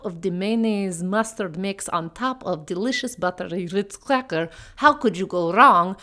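hammed-up mock Russian accent with dramatic flourishes; bad Russian accent. [name] walks through the Ritz recipe in a bad Russian accent. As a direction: present the recipe like a brooding Poe in broken Russian English. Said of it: "like Edgar Allan Poe with my delivery".

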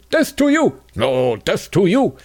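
high, hammed-up shouting cab drivers; mock cab driver yelling. Cab drivers yelling at each other in Tehran, mock fight. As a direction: play two cabbies hurling insults. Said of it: "They were yelling at each other".

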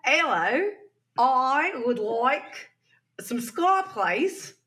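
high, slow, hesitant put-on Australian accent; Australian walking into a UK pub, mock Australian accent. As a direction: order timidly in a hammed-up Australian accent. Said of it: "an Australian walks into a U K pub".

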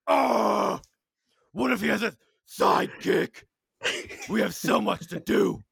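Lego Batman voice. Acted. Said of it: "he's playing the Lego Batman voice".

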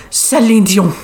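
Celine Dion said with French-style Dion; affected name pronunciation. Retold or imitated. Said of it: "she says it like that".

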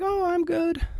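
very high, light little voice; imitated oblivious mom. Mom forgetting why she rang, imitated airy dismissal. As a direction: mimic mom brushing it off in a high voice.

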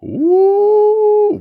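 high, loud drawn-out ooh; long scandalised ooh; surprised ooh. Real, not acted. Reacting to juicy lyric.

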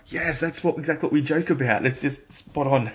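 high-pitched acted-out agreement, slowing at the end; retold emphatic agreement. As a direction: agree emphatically, as if quoting your own reaction. Acts out delighted agreement with feedback.

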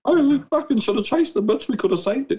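mock regret. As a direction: toss it off high as a dark joke. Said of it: "And I said".

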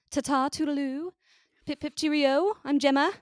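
high, loud cartoon British sign-off; hammed-up British accent, ringing out a cheery farewell; mock British accent. American host imitating British co-host signing off, mock British accent. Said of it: "That was terrible".

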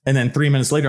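ordinary delivery

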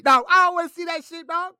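high, loud dismissal; loud refusal. Real, not acted. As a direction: shout it down. Rejecting the matchup loudly.

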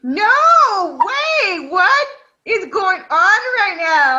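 loud disbelief, slowing down; surprised. Real, not acted. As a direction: react in total disbelief. Surprised reaction.

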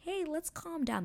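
high-pitched peacemaking; pacifying bystander. Retold or imitated. Outsiders trying to calm the bickering pair, high-pitched. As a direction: pipe up high to settle a quarrel. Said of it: "trying to be like".

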